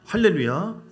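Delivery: normal talking voice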